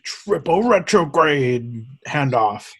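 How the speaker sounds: slow monster truck announcer; monster truck rally voice. Pretend. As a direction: stretch it out like a rally ad. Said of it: "I had to say that in the monster truck rally voice".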